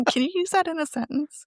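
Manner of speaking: high voice breaking into laughter; giggling high mock quote; laughing. Real, not acted. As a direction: laugh through the question. Laughing retelling spelling bee.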